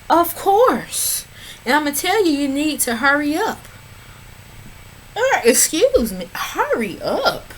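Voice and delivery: impatient sass at the screen; sassy impatience. Real, not acted. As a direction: tell her to hurry up with attitude. Talking back at the TV.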